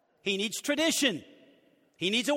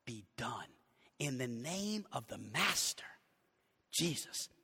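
quiet reading; 9 semitones lower, 9 dB quieter than usual. Shown as a demonstration. Pastor softly reading the Message paraphrase to his church. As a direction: read it hushed and deliberate. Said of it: "how old Eugene Peterson puts it".

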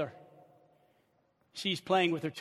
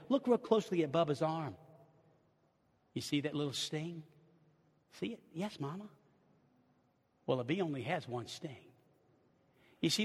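soft, hushed, reassuring mother; calm the frightened girl softly; gentle mother; 5 semitones lower, 8 dB quieter than usual. Pretend. Mother reassuring her scared little girl, soft and gentle.

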